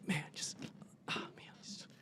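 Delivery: whispered, barely voiced mumbling; mumbled whisper. Stranger mutters to himself on the bench, whispering. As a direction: imitate a stranger muttering under his breath.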